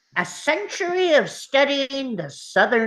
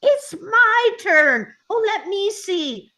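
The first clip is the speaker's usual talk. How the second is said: impatient whining; 8 semitones higher than usual. Acted. Gnome begging the boy for his telescope, impatient. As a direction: whine for your turn at a much higher pitch. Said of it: "Engiwook impatiently dances around".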